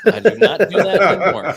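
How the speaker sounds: laughed through, high and loud; laughing denial. Real, not acted. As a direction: laugh as you swear it off. Laughing at own star chasing.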